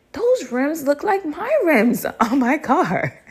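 high-pitched, drawn-out disbelief; suspicious realization. Retold or imitated. Spotting her own rims.

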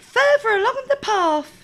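put-on high, loud childlike reply; little girl voice. A narrator's reading.

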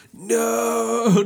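long, high drawn-out cry of "no"; hammed-up horror, stretched right out; mock movie scream. Imitating the steamroller scene in Austin Powers, mock scream. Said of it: "that scene in Austin Powers".

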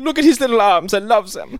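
high mock German accent; Uwe Boll. Director adoring the T-Rex's little arms, mock German accent. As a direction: dote on it in exaggerated German accent. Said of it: "That's what he sounds like".

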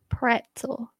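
soft, careful Pretzel as "PRET-sul"; careful standard pronunciation. Shown as a demonstration. Woman manages Pretzel for the others, carefully as "PRET-sul". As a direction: sound the T in Pretzel, leave out any N. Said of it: "There you go".

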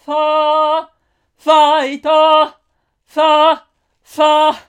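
high, loud shouted Fa; shouting. Acted. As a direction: belt the Fa out hard. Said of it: "ちゃんと叫びたいよね".

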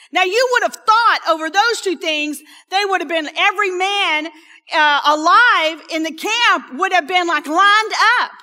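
loud, high, rising emphasis; loud preaching. Real, not acted. Preacher to congregation, loud and high. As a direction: preach it out at full force.

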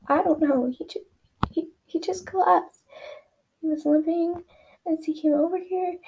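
high, shaky frightened voice; quivering voice. Acted. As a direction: speak up high, voice trembling. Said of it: "her voice quivering".

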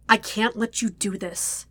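ordinary delivery

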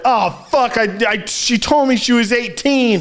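a cornered man spilling it all; panicked confession. Pretend. Bobby caught, confessing.

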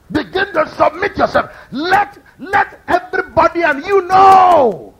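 loud, slow exhorting preacher; preaching at full force. Acted. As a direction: drive it home to the church.

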